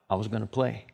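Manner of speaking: low, soft imitation of a child's excuse; sheepish kid. Kid answering a parent who wants help, mock sheepish. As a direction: drop low and soft for the kid's reply.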